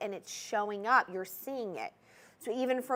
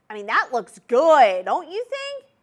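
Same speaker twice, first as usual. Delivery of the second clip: much louder, higher burst of delight; excited gushing; 3 semitones higher, 12 dB louder than usual. Real, not acted. Host showing the ring to viewers, excited loud gush. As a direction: gush over the ring on the finger.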